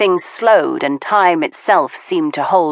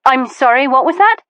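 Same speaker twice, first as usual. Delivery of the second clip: jumps much higher, caught off guard; as if her words were cut off mid-breath; startled; 7 semitones higher than usual. Acted. Veronica, interrupted mid-confession, startled and rising high.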